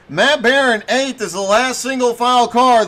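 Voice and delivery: ordinary talk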